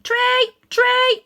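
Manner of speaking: very high, loud chorus; crowd shouting. Acted. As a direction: yell it back as many voices. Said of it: "said a dozen voices".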